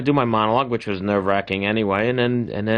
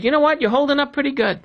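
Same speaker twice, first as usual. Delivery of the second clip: much higher, chirpy imitation of the sound man; mic guy's compliment; faster than usual. Host retelling the microphone guy's compliment, high mock voice. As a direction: hike the voice up to tease the old compliment. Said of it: "He goes".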